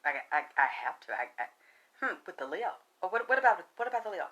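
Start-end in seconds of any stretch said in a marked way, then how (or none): none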